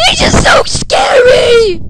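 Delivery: loud, slow, scared yell; frightened shout. Real, not acted. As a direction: shout it out, truly spooked. Terrified after jump scare.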